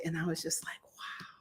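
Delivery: breathless whispered wow; softly whispered amazement; awed whisper. Real, not acted. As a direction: hush it like you're stunned.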